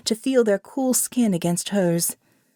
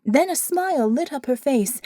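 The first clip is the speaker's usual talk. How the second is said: ordinary delivery, nothing marked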